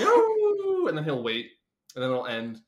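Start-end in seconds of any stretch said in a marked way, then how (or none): 0.0-0.9 long high howling "ooh"; imitated dog howl. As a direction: howl like a spooky graveyard dog. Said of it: "it'll just be".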